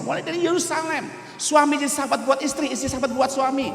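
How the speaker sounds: rally the congregation, building; preaching. Acted.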